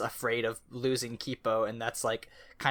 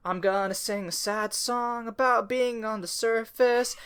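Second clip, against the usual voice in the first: higher, slow, mock-mournful announcement; mock sad singer; 9 semitones higher than usual. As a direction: ham up a gloomy little ballad intro. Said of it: "she's like".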